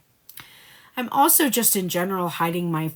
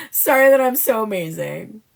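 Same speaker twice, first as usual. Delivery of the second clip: louder, higher mock apology; sorry not sorry; 4 semitones higher, 7 dB louder than usual. Voicing the classic Divine Feminine, sorry not sorry. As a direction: boast it as a cheeky non-apology. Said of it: "like, classic Divine Feminine".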